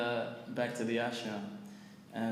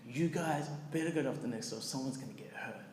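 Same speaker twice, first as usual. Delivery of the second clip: ordinary delivery, nothing marked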